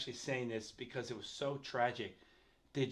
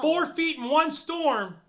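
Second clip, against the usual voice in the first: high, loud and slow in disbelief; amazed; 13 semitones higher, 10 dB louder, slower than usual. Real, not acted. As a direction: react like you can't believe it. Amazed at snow amount.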